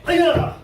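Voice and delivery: very loud piercing high yell; kiai shout. Shown as a demonstration. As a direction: explode the yell on the break.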